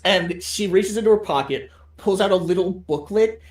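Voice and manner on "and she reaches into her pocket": ordinary talk, nothing marked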